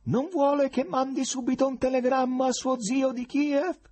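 high, loud call through cupped hands; shouting through cupped hands. Acted. Professor shouting through cupped hands at the running Berlioz. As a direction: shout after him as through a megaphone. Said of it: "gridò, mettendo le mani a megafono".

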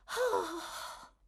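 soft, high, drawn-out sigh; high feminine sigh. A narrator's reading. Mrs Corney beside Bumble, sighing. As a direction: let out a languishing sigh. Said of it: "La signora Corney sospirò".

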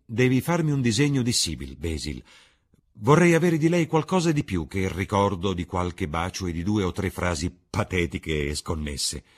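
lightly voiced eager young man, rising at first; impassioned Dorian. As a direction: make a heartfelt request, pushing the first words.

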